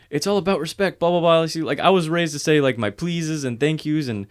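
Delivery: high-pitched mock sermon from a coworker; mocking lecture.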